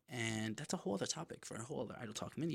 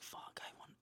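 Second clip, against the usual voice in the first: breathless whispered curse; 18 dB quieter than usual. Retold or imitated. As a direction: hiss it under your breath. Inner thought while struggling on bench.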